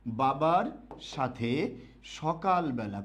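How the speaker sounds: slow, spelled out for writing; slow dictation. Shown as a demonstration. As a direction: dictate the sentence slowly. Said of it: "আমি বাক্য লিখলাম". (ধীরে ধীরে শ্রুতলিখন)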